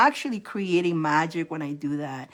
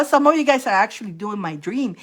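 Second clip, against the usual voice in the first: ordinary delivery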